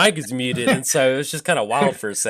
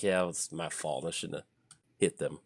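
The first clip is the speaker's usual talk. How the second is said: soft, lowered confession; sheepish admission; 8 semitones lower, 13 dB quieter than usual. Retold or imitated. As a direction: own up quietly, embarrassed. Sheepish admission after reckless play.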